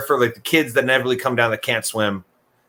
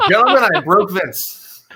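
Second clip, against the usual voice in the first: slow amused proclamation; laughing announcement; 6 semitones higher, 4 dB louder, slower than usual. Real, not acted. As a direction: announce it while still laughing. Host laughing at listener.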